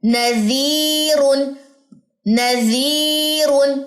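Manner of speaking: نذیرن with the ی drawn out slowly; long vowel. Shown as a demonstration. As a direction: stretch the ی in نذیرن. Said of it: "دو second". (یا مد کے ساتھ نذیرن)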